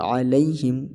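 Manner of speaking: "علیہم" with ain and a lai glide to ee; a-lay-him, tight y in "علیہم"; clear lai. Shown as a demonstration. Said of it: "اس کو درست پڑھنا ہے". ("علیہم" لئی کے ساتھ)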